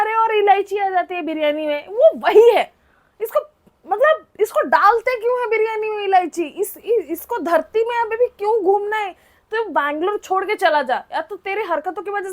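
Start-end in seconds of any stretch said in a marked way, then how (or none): none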